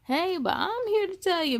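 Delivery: usual speaking voice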